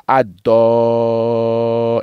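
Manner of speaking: "adolin" with a long "do", shorter long "li"; very slow, sustained vowels in "adolin"; pressed and stretched. Shown as a demonstration. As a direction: lean on "adolin", holding the "do" most. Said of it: "en tirant plus fort".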